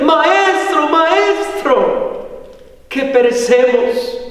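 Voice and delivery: high, urgent call to the master, acted; retold cry of men about to perish; anguished outcry. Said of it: "fue una angustia, un clamor".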